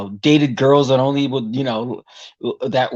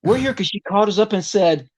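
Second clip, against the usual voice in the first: a hint of a laid-back rocker, raised higher; relaxed biker guy explaining why they came; lightly voiced rocker dude; 6 semitones higher than usual. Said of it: "She goes".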